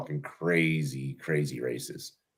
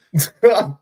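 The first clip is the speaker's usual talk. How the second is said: loud laugh; 12 semitones higher, 10 dB louder than usual. Real, not acted. Laughs after mocking imitation.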